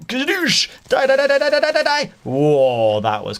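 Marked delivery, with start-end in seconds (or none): 0.1-0.7 high-pitched mock blast; imitated explosion noise. 0.9-2.1 very high, loud play shriek; rapid-fire squeal of mock attack; frantic battle cry. Battling a creeper in the game.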